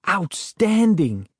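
high, slow, thrilled cry; excited exclamation. A narrator's reading. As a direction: burst out with delight. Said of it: "I exclaimed".

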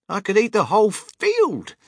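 high-pitched delighted grandpa; grinning delight. A narrator's reading. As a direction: exclaim it through a big grin. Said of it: "grinning with delight".

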